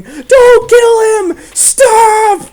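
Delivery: high drawn-out desperate scream. Retold or imitated. Begging the guards, screaming, to spare Beast. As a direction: scream for them to stop shooting. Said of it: "me and Luck were just screaming".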